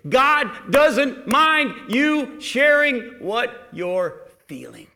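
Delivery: high, loud preaching that builds slowly; full-force preaching. Acted. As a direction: drive each line home to the congregation. Preaching climax to congregation.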